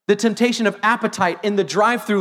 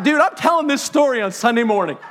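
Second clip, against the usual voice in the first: laughing tease; 2 semitones higher than usual. Retold or imitated. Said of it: "And I'm like".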